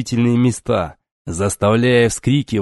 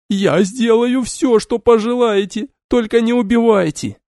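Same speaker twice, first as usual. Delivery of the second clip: frantic shouting hostage taker; 12 semitones higher than usual. A narrator's reading. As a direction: beg wildly, voice breaking. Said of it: "обезумела женщина".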